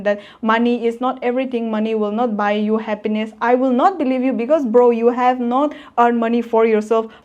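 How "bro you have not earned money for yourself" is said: usual speaking voice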